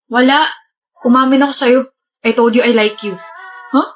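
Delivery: ordinary delivery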